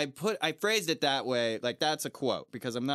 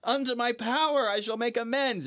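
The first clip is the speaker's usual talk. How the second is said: much higher, pleading voice; pleading knight; 12 semitones higher, 4 dB louder than usual. Retold or imitated. Defeated knight begging Gawain for his life, high pleading. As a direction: beg for mercy in a high whine. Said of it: "And he's like".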